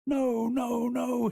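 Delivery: high, loud, held sobbing cry. A narrator's reading. Boy running away, still crying no. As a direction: keep keening on one high note. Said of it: "he repeated".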